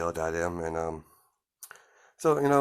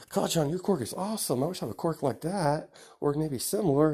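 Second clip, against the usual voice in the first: put-on much higher, eager kid voice; admiring little boy; 9 semitones higher, 3 dB louder than usual. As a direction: gush like a child in awe. Young boy admiring friend's quirk.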